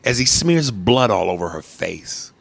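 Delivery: ordinary talk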